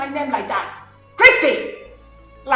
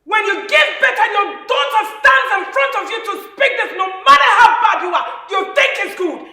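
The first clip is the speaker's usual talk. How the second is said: higher, louder scolding mother; mother scolding her children, loud and higher; angry scolding; 5 semitones higher, 6 dB louder than usual. Acted. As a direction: shame them for fighting.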